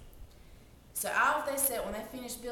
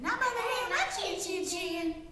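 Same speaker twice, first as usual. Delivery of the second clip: much higher, tiny piggy voice; little pig refusing the wolf in a much higher voice; 9 semitones higher, 3 dB louder than usual. Acted. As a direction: squeak it out like a defiant little pig. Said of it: "The little pig said".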